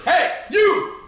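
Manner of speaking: high, drawn-out heckling shout; mock yell. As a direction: yell it slowly, like telling off moviegoers. Mocking how the manager yelled.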